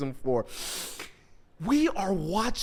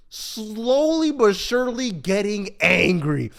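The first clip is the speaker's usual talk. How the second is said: normal talking voice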